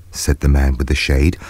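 ordinary delivery, nothing marked

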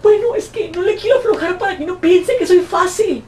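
high, laughing girly mock voice; mocking woman. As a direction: parody a coy woman playing hard to get. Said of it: "andarse de que".

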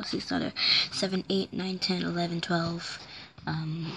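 soft counting under breath; muttering count. Acted. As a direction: count the coins quietly.

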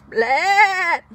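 loud crying blat; loud bawling blat; baby tapir bawls. Acted. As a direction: bawl like a baby animal. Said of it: "bawls Baby Tapir".

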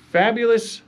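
ordinary talk, nothing marked

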